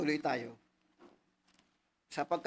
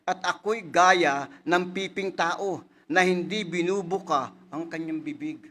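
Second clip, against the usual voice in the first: slow, louder solemn reading of the verse; scripture reading; 2 semitones higher, 7 dB louder, slower than usual. Acted. Preacher reading the Psalm to his flock, slow and loud. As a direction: read it out to the church like holy writ.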